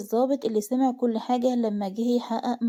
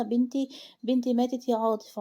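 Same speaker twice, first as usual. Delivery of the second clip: ordinary delivery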